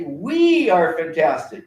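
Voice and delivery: slow, loud chant with stress on We; rally call. Shown as a demonstration. Teacher leading pupils in the rally call, slow and loud. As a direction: call it out slowly to the room. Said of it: "I want everyone to say".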